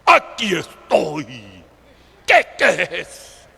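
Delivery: loud, slow, low, menacing figure of death; ominous Death, answering a summons; dark, strong voice of death. Retold or imitated. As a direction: boom it out slowly from the depths. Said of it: "la muerte con una voz tenebrosa y fuerte".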